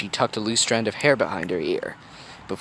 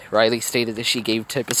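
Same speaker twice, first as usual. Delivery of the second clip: plain reading voice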